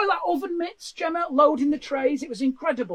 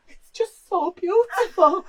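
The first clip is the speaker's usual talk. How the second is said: slow, mock-tearful gush; mock emotional fan; 4 semitones higher, slower than usual. Facebook viewer moved by baking pasties, mock tearful. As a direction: overdo the tears over a pasty. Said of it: "People were getting emotional".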